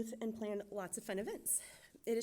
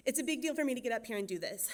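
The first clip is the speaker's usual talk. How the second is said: usual speaking voice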